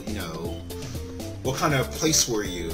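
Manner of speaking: ordinary talk, nothing marked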